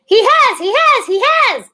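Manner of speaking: very high, loud squeak; very high panicked squirrel squeak; squeaking young squirrel. A narrator's reading. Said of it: "it squeaked".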